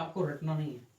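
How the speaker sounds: usual speaking voice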